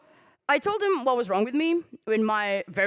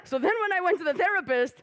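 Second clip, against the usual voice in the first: usual speaking voice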